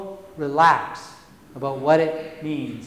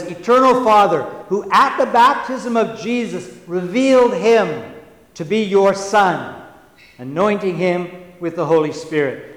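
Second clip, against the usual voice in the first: prayer reading; 2 semitones higher, 4 dB louder than usual. Acted.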